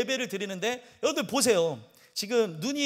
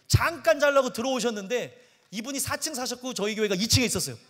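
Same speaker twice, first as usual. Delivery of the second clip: usual speaking voice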